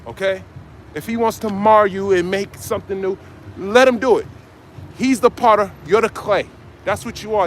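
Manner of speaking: normal talking voice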